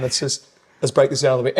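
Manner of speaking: normal talking voice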